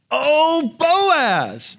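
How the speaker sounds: high, loud swooning "Oh, Boaz"; delighted gasp. Acted. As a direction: gush it like a swooning bride. Exclaiming delight in Ruth story.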